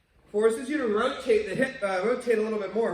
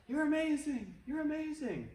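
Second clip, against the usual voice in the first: higher, softer each time; fading echo; 8 semitones higher, 5 dB quieter than usual. Retold or imitated. The valley echoes the kindness back, fading. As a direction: let it ring back and fade. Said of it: "they heard back".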